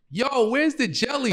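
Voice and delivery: high-pitched, drawn-out mock yell; comic high holler for missing jelly; retold mad scream. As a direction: holler it slowly up high to a brother across the house. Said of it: "I scream mad loud".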